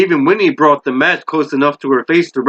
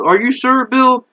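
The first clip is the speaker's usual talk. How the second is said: anxious high; 9 semitones higher than usual. Acted. Richie, anxious and hopeful.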